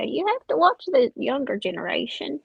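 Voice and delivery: ordinary talk, nothing marked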